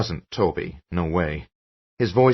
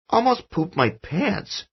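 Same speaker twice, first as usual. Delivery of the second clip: put-on much higher, slow boy voice; a relieved giggly kid; child's voice; 13 semitones higher, slower than usual. A narrator's reading. Son joking with father, high child voice.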